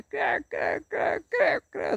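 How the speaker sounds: high, shrill crá crá of a flock; imitating parrots screeching in the pine tree; parrot squawks.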